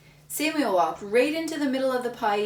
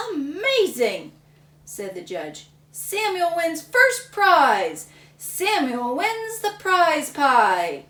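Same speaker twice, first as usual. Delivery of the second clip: slow, loud, higher proclamation; announcing winner; 6 semitones higher, 7 dB louder, slower than usual. Acted. Judge proudly announcing Samuel as winner, grand.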